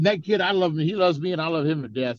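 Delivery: normal talking voice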